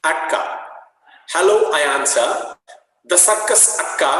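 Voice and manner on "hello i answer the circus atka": louder, higher read of the dialogue; rereading the story to students; reading aloud. Acted. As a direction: read it out clearly for the class.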